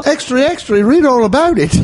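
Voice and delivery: high British paperboy cry; mock British newsboy. Host playing paperboy, high British cry. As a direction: pitch the call up, British. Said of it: "as a British person".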